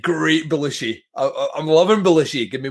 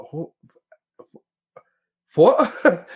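stunned what; 6 semitones higher than usual. Retold or imitated. Retelling a horror scene, a high, stunned "what?". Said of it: "I was like".

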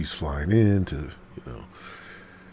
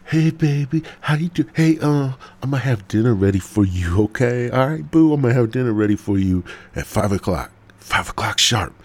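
put-on sweet-talking boyfriend; 5 semitones higher, 5 dB louder than usual. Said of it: "I'll say".

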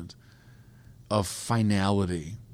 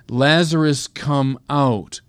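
raised, commanding call, retold; loud command; 5 semitones higher, 5 dB louder, slower than usual. Jesus calling the dead man from the tomb, loud command. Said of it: "And in a loud voice".

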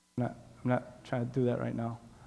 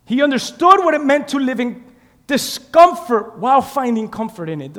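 emphatic preaching; 10 semitones higher, 13 dB louder than usual. Acted. Preacher restating how Paul lived, loud emphatic preaching. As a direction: proclaim it forcefully to the room.

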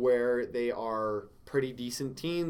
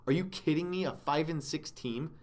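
ordinary delivery

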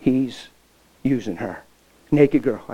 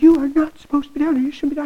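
her scolding voice; 13 semitones higher, 8 dB louder, faster than usual. Retold or imitated. Girl scolds him again.

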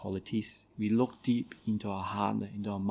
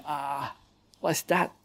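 sudden pained tortoise cry, higher; 5 semitones higher, much slower than usual. Acted. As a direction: yelp it out in pain. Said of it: "he shouted in pain".

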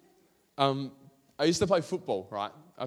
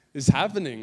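excited outburst; 2 semitones higher, 11 dB louder, faster than usual. Retold or imitated. Retold thrill at state trial letter.

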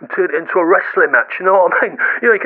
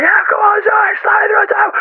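very high, urging fans; high-pitched mock cheering; excited crowd; 13 semitones higher than usual. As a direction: egg them on like a rowdy crowd. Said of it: "people are going".

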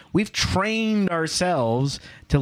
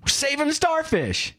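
put-on earnest little kid; 12 semitones higher than usual. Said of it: "the little kid says".

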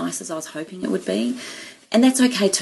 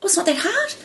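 much higher, delighted realisation; light up as it finally clicks; surprised relief; 13 semitones higher than usual. Retold or imitated. Retelling her breakthrough to her mentor, higher delighted surprise. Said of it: "And I went".

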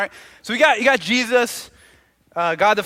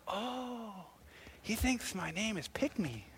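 very soft, slow murmur; dawning realization; 16 dB quieter, much slower than usual. Acted. Mom realizes the boy's mistake, soft slow realization. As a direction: let it sink in under the breath. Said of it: "dawned on my mom's like".

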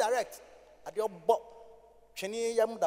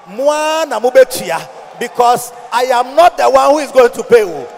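loud, high shouted sermon line; shouted preaching; 4 semitones higher, 17 dB louder than usual. Acted. Pastor defending offerings, loud shouted preaching. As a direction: fling it at the congregation.

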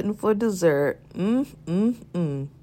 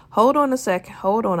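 urgent hold on; 3 semitones higher, 7 dB louder than usual. Acted. Urgently putting Tiffany on hold.

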